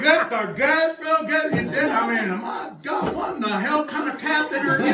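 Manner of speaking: high, loud mock bellow at the captain; exasperated boat owner hollering; angry captain yelling. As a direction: yell at him like a furious old skipper.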